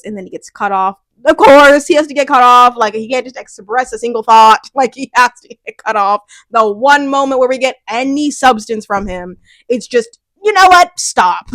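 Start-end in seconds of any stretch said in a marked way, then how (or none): 1.3-6.2 loud, indignant complaint; exasperated outburst. Real, not acted. Exasperated outburst about the character. 10.4-11.5 loud, high burst, then a firm stop; exasperated. Real, not acted. Exasperated interjection at the show.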